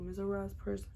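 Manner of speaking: normal talking voice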